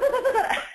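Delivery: high laugh breaking out; burst of laughter. Real, not acted. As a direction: laugh at your own joke. Laughing at own impression.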